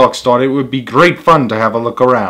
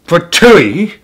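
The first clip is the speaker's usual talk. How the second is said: sharp raised yelp of a scalded bear; Papa Bear outcry; 6 semitones higher, 5 dB louder than usual. Acted. Papa Bear burning his mouth, a loud yelp. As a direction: burst out as the scalded father bear.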